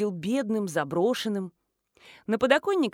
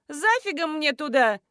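higher-pitched indignant comeback; defiant retort; 6 semitones higher, 4 dB louder than usual. A narrator's reading. Daughter-in-law refuses to go home, defiant retort. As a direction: answer back with attitude. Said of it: "огрызнулась Надежда".

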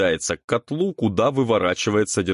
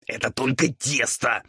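higher, raspy ghoul voice, lightly hissed; ghoul hissing; 5 semitones higher than usual. A narrator's reading. Ghoul scolds devil over the dough, hissing. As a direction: hiss it as an exasperated ghoul. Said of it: "зашипел на него упырь".

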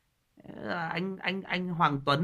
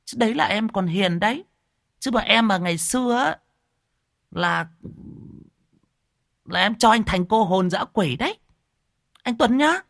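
mock threat; 5 semitones higher, 4 dB louder than usual. Real, not acted. Half-joking threat to Tuấn.

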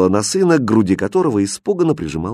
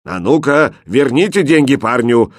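loud, higher-pitched stern order; angry man's voice; 4 semitones higher, 5 dB louder than usual. A narrator's reading. Stranger ordering the boys to return money, angry. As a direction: order them sharply, raising the voice. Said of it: "раздался сердитый мужской голос".

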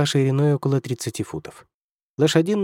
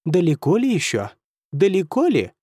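higher teasing imitation of the question; mocking mimicry; 6 semitones higher than usual. A narrator's reading. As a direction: parrot his words back, mocking. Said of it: "передразнил его Кервиси".

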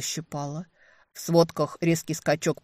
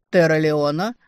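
loud, lightly voiced velvety stranger; velvety male voice; 9 dB louder than usual. A narrator's reading. Unknown man calling on the line, velvety male voice. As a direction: greet her with a hint of velvet. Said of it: "прозвучал незнакомый бархатистый мужской голос".

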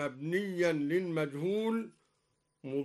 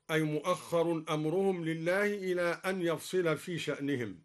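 usual speaking voice

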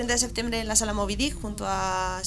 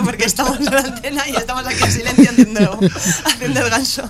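laughing; 3 semitones higher, 13 dB louder than usual. Real, not acted. Band laughing loudly in studio.

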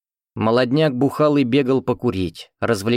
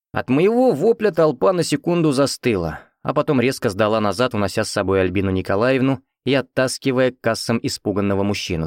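usual speaking voice

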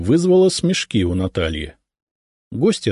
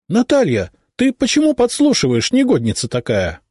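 high, louder indignant scolding; 12 semitones higher, 3 dB louder than usual. A narrator's reading. Mother catching her daughter eavesdropping, indignant. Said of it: "возмутилась маман".

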